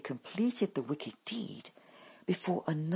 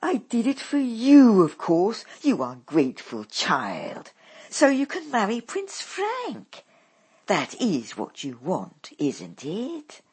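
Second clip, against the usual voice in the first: scolding mother; 5 semitones higher, 9 dB louder than usual. A narrator's reading. Mother scolds ungrateful daughter.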